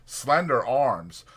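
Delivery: plain reading voice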